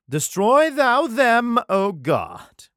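high, slow, loud declaiming; dramatic cry. Acted. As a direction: call down destruction like a prophet. Dramatic line of the psalm.

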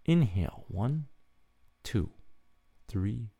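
slow, soft counted breathing; guided breathing. Acted. Host leading listeners through bedtime breathing, slow soft counting. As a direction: guide them calmly through each breath.

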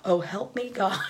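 mock weary sarcastic plea. Kid taught to call on God, mildly sarcastic. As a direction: toss it off as a lightly sarcastic prayer. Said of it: "he said it in the sarcastic tone".

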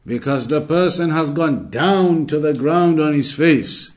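usual speaking voice

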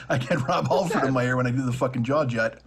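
normal talking voice

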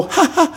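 high mock "ha, ha"; imitated laugh. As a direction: fake a short audience laugh. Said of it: "you didn't go".

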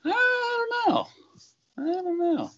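high, slow, drawn-out silly voice; goofy high I don't know. Pretend. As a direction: play dumb in a squeaky voice. Unsure how persona began.

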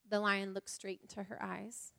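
ordinary talk, nothing marked

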